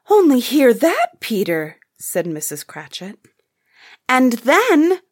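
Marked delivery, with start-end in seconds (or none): 0.1-1.8 high, loud start dropping on the name; excited exclamation. Acted. Missus Cratchit to her son Peter, excitedly exclaiming. Mother delighted at son's prospects. 4.1-5.0 high, loud exclamation; girl's excited cry. A narrator's reading. One of the girls breaking into family talk, crying out. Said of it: "cried one of the girls".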